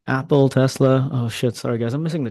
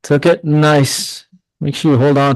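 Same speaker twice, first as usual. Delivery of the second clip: higher, louder delighted praise; excited approval; 3 semitones higher, 8 dB louder, slower than usual. Real, not acted. Streamer praising a viewer who took the trade, delighted. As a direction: cheer the viewer on.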